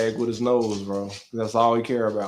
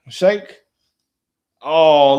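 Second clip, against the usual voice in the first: normal talking voice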